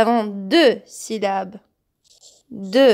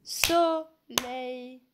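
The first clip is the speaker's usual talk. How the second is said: Soleil said slowly in two clapped beats, so-leil; clapping syllables; 3 semitones higher, 9 dB quieter than usual. Shown as a demonstration. Teacher clapping out the syllables of Soleil for pupils.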